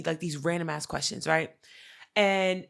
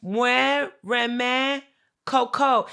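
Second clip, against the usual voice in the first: higher, halting imitation of a learner; imitating innocent parroting; 6 semitones higher, 5 dB louder, much slower than usual. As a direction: parrot foreign words one by one, higher. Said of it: "she's just repeating what he says".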